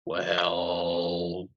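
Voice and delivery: long drawn-out low "well"; slow, quiet "well" of mock surprise; mock-stunned reaction. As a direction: stretch it out low and soft. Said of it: "and I'm like".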